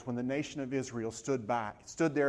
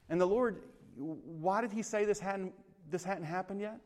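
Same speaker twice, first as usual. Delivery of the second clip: ordinary talk, nothing marked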